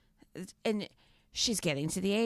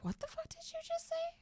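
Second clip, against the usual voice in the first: much higher, squeaky disbelief; shocked mom; 13 dB quieter than usual. Retold or imitated. Mom reacting to daughter's swearing, high squeaky disbelief.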